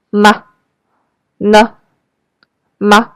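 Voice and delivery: "ma" and "na" short, mid-pitched; neutral tone. Shown as a demonstration. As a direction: keep "ma" and "na" brief and level. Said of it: "somewhere in the middle and very short".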